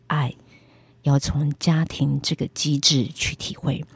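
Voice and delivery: slow, soft, word by word; slow and weighty. Retold or imitated. Retelling what God said to her, slow and weighty. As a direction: lay out each word with weight. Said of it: "每个字带着一种很大的力量".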